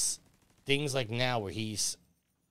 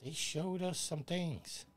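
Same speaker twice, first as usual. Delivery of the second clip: sarcastic; 7 semitones higher, 5 dB quieter, much slower than usual. Retold or imitated. Mocking retort to a complainer.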